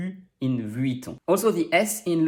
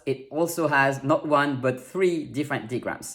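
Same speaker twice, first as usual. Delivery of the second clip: ordinary talk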